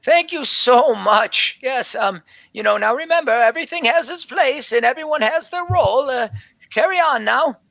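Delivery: high, fluttery voice of an ancient elven woman; flustered old elf scribe. Acted. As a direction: play a scatterbrained old elf surprised by visitors. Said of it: "and she says".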